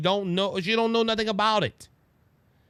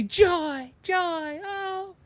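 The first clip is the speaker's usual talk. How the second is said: high, airy mock politician; singsong slogans, stretched out; Kamala Harris; 11 semitones higher, much slower than usual. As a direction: imitate her in a lilting high voice. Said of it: "she's trying to say".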